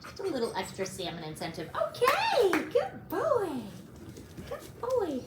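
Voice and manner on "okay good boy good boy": high, bright praise for a good dog; puppy praise. Real, not acted. Trainer praising her puppy for lying down, high excited praise. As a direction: gush over the puppy in a high voice.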